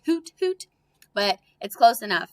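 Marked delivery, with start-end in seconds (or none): 0.0-0.7 high hooting owl call. Pretend. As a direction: hoot like an owl. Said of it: "owls usually say".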